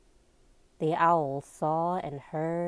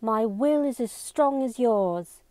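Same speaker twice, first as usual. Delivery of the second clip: higher, forceful declaration; intense; 6 semitones higher, 4 dB louder than usual. A narrator's reading. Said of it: "spoke with even more intensity now".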